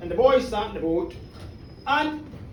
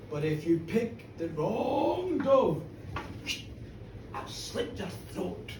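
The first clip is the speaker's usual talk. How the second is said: slow menace sinking to a whisper; sinister threat; 4 semitones lower, 6 dB quieter, slower than usual. Acted. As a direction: draw out the warning, then whisper it. Villain threatens the old man.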